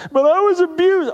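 mock protest, pitched very high; whiny objector. Objector protesting teaching on discipline, high mock wail. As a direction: cry it out high and loud in mock complaint.

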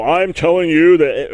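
loud, bossy mock order; commanding. Preacher mimicking someone who commands people, commanding. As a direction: bark it like you play God. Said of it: "that we command people".

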